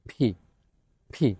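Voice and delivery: ফি with a strong puff of air after the P; aspirated P demo. Shown as a demonstration. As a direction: push the air out hard on ফি. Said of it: "ধাক্কা দিয়ে বাতাস বের হবে".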